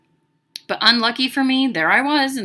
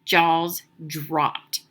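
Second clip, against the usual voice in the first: plain reading voice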